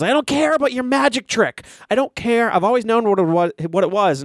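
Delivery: strained, high imitation of yelling; retold scream. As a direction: imitate a furious son screaming at his dad. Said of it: "starts screaming and says".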